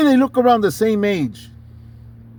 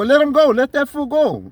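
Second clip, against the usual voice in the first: higher-pitched confrontational demand; retold challenge; 6 semitones higher than usual. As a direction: act out egging on a fight. Retold demand during street confrontation.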